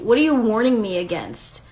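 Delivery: usual speaking voice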